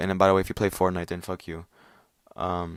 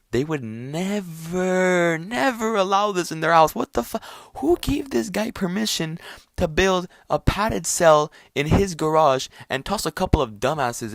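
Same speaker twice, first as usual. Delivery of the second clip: much higher, indignant scolding aunt; outraged Italian aunt; 9 semitones higher, 4 dB louder than usual. Retold or imitated. Italian aunts scolding about the garage cell, outraged. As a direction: scold like an appalled Italian grandmother.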